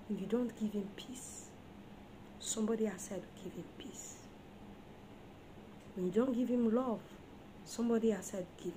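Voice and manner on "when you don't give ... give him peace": soft unvoiced warning; whisper. Real, not acted. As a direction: lean in and whisper it. Warning wives, hushed.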